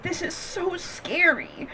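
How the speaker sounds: soft, slow, frightened whimper; mock scared. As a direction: whimper it slowly and quietly. Mimicking the driver.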